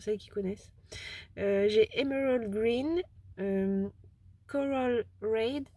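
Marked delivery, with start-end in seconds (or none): 2.0-5.6 slow, careful French-accented English; self-mocked English accent. Real, not acted. Vlogger showing off paint colours to viewers, self-mocked English accent. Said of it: "Avec mon superbe accent anglo-saxon".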